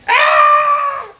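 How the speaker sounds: very loud, high-pitched scream. Real, not acted. Guest packing up to leave, sudden shrieking scream. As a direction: shriek at full volume.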